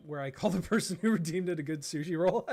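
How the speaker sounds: reading through giggles; laughing. Real, not acted. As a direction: giggle your way through the line. Laughing while reading redeem.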